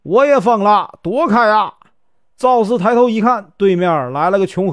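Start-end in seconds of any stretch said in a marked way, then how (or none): 0.0-1.7 loud, higher call from across the road; loud mock-mad call. A narrator's reading. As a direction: shout to clear the way. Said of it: "只见对面有人喊".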